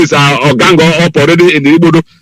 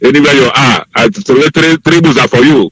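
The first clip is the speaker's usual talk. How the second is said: ordinary delivery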